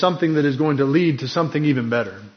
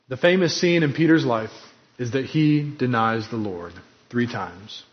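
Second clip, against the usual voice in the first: ordinary talk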